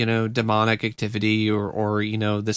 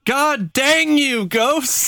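PG swearing; 13 semitones higher, much slower than usual. Retold or imitated. Joining the PG swearing bit.

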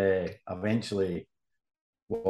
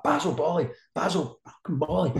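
much higher furious roar of the name; roaring boss; 8 semitones higher than usual. Retold or imitated. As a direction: roar the name in the reporter's face. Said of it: "he's roaring at me".

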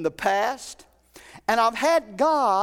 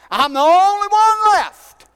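higher and louder, drawn out on only one; whiny Elijah, slow; 9 semitones higher, 9 dB louder than usual. Retold or imitated. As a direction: stretch it out, feeling sorry for yourself. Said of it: "Isn't that what Elijah said?".

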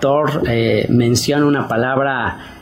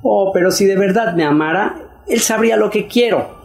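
ingenua soñadora; 6 semitones higher than usual. Acted. Wife imagining husband reads minds.

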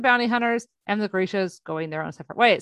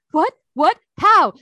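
shocked disbelief; 7 semitones higher, 9 dB louder, slower than usual. Retold or imitated. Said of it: "He's like".

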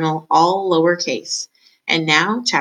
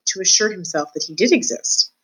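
ordinary delivery, nothing marked

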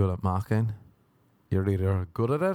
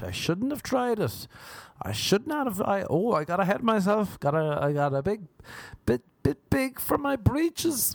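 mock self-pity; 10 semitones higher than usual. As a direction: pitch way up and wail at your own failure. Mocking self-pity in own quote.

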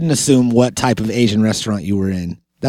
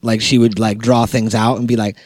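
ordinary talk

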